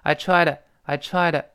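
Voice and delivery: "I tried" ending in a light da; "I tried" with a gentle voiced da; voiced flap. Shown as a demonstration. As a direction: soften the t-to into da after "tried". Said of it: "有的轻轻浊化成的这个声音".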